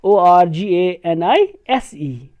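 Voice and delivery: slow letter-by-letter dictation; slow teacher spelling. Shown as a demonstration. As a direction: spell each letter slowly. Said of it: "British English में लिखते हैं".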